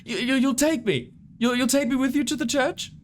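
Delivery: high, halting falsetto plea; stammering monster-woman, pitched high; impeded voice. Acted. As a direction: ask hopefully in a high, obstructed voice. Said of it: "her voice is being impeded by the skin".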